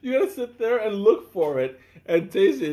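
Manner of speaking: high, fast, laughing self-quote; laughing instruction. Retold or imitated. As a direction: rattle it off through laughter. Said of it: "I said".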